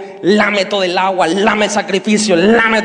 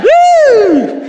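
taunting whoop; 7 dB louder than usual. Retold or imitated. Taunting yell after the jeer.

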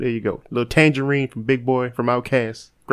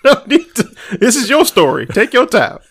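laughing tease; 9 semitones higher than usual. Real, not acted. Teasing the ranting cohost.

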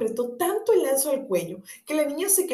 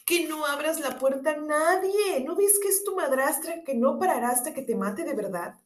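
worried; 6 semitones higher than usual. A narrator's reading. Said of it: "dijeron muy preocupados".

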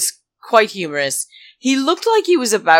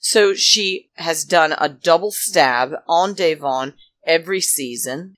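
ordinary delivery

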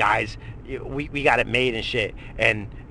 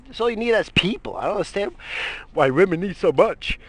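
shrill, much higher exclaim; high-pitched exasperation; 9 semitones higher, 3 dB louder than usual. Acted. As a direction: throw your voice up, exasperated. Repeats line, high and loud.

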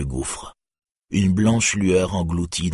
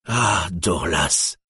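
exclaiming; much slower than usual. A narrator's reading. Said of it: "s'exclama-t-il".

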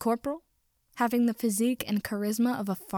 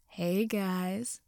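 put-on coarse thug voice; drawn-out greeting with a coarse edge; coarse cackling stranger. A narrator's reading. Stranger greeting the group in a dark alley, coarse. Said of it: "a coarse voice cackled in the dim".

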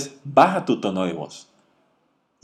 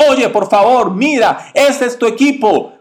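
higher and louder, like a scolding boss; 10 semitones higher, 4 dB louder than usual. Shown as a demonstration. Coach demoing the wrong tone on a client, loud and high. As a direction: raise the pitch and push it out, mock scolding. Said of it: "un tono de voz alto".

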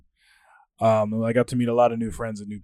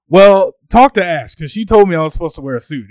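indignant comeback; 5 semitones higher, 12 dB louder than usual. Retold or imitated. Acted defence in the quarrel.

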